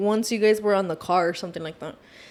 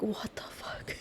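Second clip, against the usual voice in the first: whisper; 18 dB quieter, slower than usual. Retold or imitated. Shocked, hearing him scream outside.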